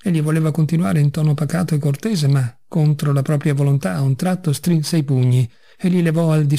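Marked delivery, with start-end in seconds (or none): none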